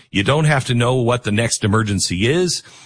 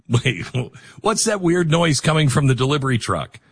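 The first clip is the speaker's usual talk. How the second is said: ordinary delivery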